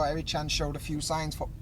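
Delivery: ordinary delivery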